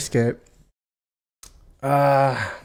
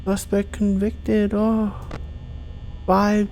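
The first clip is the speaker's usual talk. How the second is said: higher, slow, hammed-up mourning; mock dramatic lament; 6 semitones higher, 4 dB quieter, slower than usual. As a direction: ham up a tragic announcement, slowly. Mock mourning game screens.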